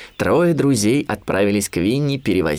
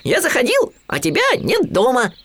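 tiny, very high piglet voice; little piglet; 13 semitones higher than usual. A narrator's reading. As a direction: pipe up like a small piglet. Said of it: "сказал Хрюня". (тоненький голосок поросёнка)

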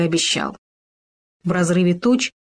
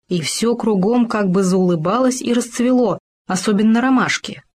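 ordinary delivery, nothing marked

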